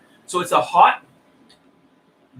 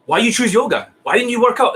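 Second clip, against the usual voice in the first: higher, pressing mock questions; interrogation; 5 semitones higher than usual. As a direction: fire the questions like a cross-examiner. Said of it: "It's like, holy shit, interrogation".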